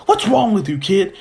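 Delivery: scolding. Retold or imitated. Gran Torino stopping the fight, scolding.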